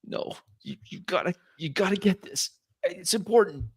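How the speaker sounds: high, strained plea; through gritted teeth. Acted. Wounded Isabel urging him to take it, through gritted teeth. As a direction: force it out through pain. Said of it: "her gritted teeth".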